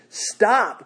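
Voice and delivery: loud, high shout of stop; Paul's urgent loud cry. Acted. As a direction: yell it to halt the sword. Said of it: "cries out in a loud voice".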